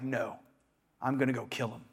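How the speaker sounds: low, hushed mock resolve; imitated defiance, kept low and quiet; quiet defiant refusal. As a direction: voice a stubborn refusal, low and soft. Voicing Paul refusing to believe.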